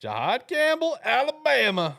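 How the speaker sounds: booming high-pitched hype call; hyped-up echo. Retold or imitated. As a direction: crow the name back way up high. Loudly echoing the announced pick.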